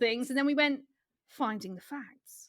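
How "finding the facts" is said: slow, soft title read; announcer voice. Acted. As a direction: present the segment name slowly.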